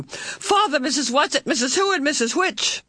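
high-pitched, impatient introductions; angry impatience. A narrator's reading. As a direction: rattle off the names in high exasperation. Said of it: "Meg's angry impatience grew".

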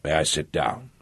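quiet, thin and hoarse. A narrator's reading. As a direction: rasp it out weakly, barely there. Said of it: "in a thin, hoarse voice".

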